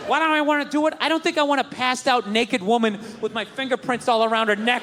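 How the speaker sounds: usual speaking voice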